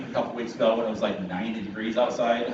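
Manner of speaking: usual speaking voice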